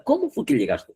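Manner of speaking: very high-pitched "Cómo fue que llegaste", quick demo question; question intonation. Shown as a demonstration. Teacher repeating the interrogative example, high questioning voice. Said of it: "Es una pregunta".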